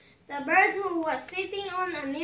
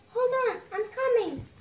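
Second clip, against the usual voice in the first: urgent call; 6 semitones higher, 4 dB louder than usual. Acted. Bird rushes to rescue.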